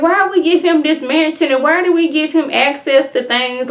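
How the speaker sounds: high-pitched, baffled complaint; incredulous protest. Real, not acted. Recapper questions giving him a mansion, incredulous. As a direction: question it like it makes no sense at all.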